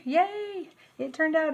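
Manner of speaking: high, bubbly yay of delight; happy burst, pitch jumping up; excited squeal. Real, not acted. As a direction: squeal with real joy. Cooking vlog, waffle turned out.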